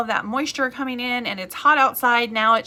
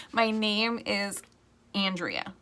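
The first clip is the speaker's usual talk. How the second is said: slow emphasis; 4 dB quieter, slower than usual. Shown as a demonstration. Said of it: "you gotta know my name".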